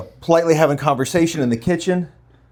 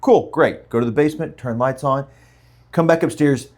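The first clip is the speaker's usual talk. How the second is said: ordinary talk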